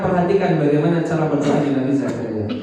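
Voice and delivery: ordinary talk, nothing marked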